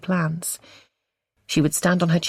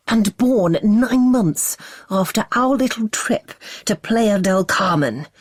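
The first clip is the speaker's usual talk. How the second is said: higher, slow, sneering fury; scornful fury; 5 semitones higher, slower than usual. Acted. Husband mocking their trip, slow scornful fury. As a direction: sneer it out with contempt. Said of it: "adding scorn to his fury".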